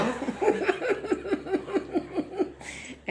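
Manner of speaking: long, high pulsing laugh; burst of laughter. Real, not acted. Actress preparing to perform, high helpless laughter.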